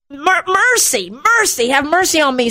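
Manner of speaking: high-pitched repeated plea; acted insistent yell. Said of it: "he yells louder".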